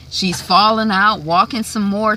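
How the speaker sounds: ordinary talk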